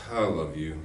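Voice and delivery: low, quiet "I love you" with weight on "I"; low and soft, pressing on "I"; stress on I. Shown as a demonstration. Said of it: "It can be".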